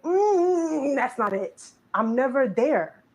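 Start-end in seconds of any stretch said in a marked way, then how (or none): 0.0-1.6 disapproving. Retold or imitated. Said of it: "look at what they're doing and say".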